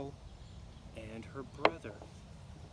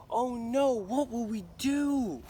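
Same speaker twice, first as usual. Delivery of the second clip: louder, higher, slow alarmed cry; frightened girl; 9 semitones higher, 6 dB louder, slower than usual. Acted. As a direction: cry out in fear.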